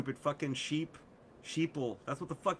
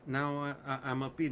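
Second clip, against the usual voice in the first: normal talking voice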